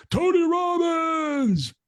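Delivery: booming stretched arena announcer call; announcer intro. Retold or imitated. Speaker retelling the arena introduction, booming announcer shout. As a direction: belt the name out like a hype announcer.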